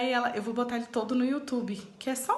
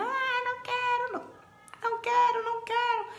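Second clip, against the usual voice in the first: put-on high, whiny child's wail, much higher pitch; imitating crying child; 12 semitones higher than usual. Mother imitating her daughter's crying protest at bath time, high whiny wail. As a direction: mimic a little girl sobbing that she won't leave the bath. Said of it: "e ela chorando".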